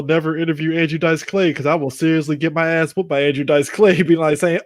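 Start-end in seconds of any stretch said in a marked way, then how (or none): none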